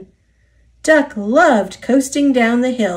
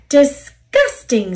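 slow, higher, louder disgust; indignant; 5 semitones higher, 5 dB louder than usual. A narrator's reading. James reacts to Duck's supposed joke, indignant. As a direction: stretch it out in outraged disgust.

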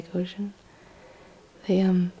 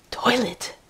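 retold freak-out; 4 semitones higher than usual. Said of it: "freaks out and he goes".

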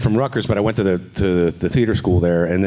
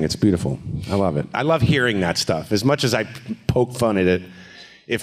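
ordinary delivery

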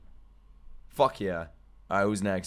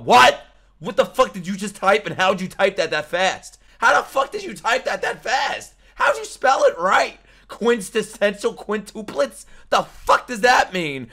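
loud, higher disbelieving yells; shocked outburst; 6 semitones higher, 6 dB louder than usual. Real, not acted. Streamer shocked at chat's fast typing, shouting in disbelief. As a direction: react in shock, voice climbing.

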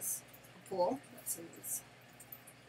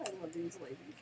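ordinary delivery

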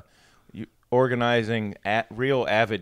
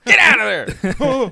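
drawn-out, high, loud holler; demonstrated yell; 11 semitones higher, 9 dB louder, slower than usual. Shown as a demonstration. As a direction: holler it at someone from a truck window. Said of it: "Just yell".

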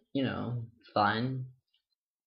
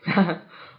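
short laugh; 7 semitones higher, 9 dB louder than usual. Real, not acted. Laughing on phone with friend.

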